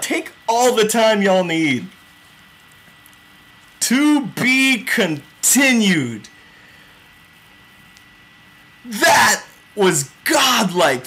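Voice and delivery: stretched-out, reverent hype; awed and emphatic. Real, not acted. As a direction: savour each word in amazement. Awed after the reveal ends.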